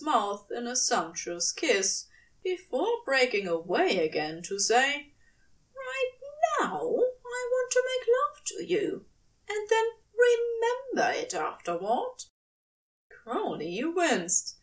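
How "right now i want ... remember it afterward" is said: higher voice, intimate and eager; angel desiring. A narrator's reading. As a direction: say it with hungry intent. Aziraphale voiced high, wanting.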